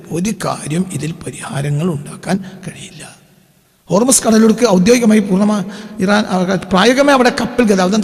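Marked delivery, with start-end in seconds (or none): 0.1-3.2 whisper. Real, not acted. Commentator drops voice mid-point.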